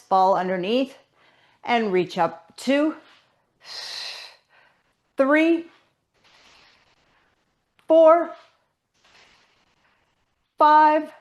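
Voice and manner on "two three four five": high, louder called count; an effortful high count to the class. Real, not acted. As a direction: push each number out like a coach calling reps. Instructor calling out the count.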